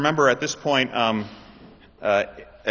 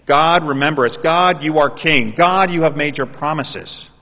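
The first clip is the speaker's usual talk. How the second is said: loud prayer; 3 semitones higher, 7 dB louder than usual. Acted. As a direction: call out to God with the whole congregation. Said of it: "we're saying".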